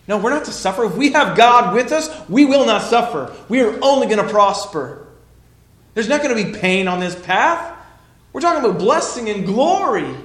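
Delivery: high, loud, insistent voice; hammed-up Peter, sure he is right; Peter protesting eagerly.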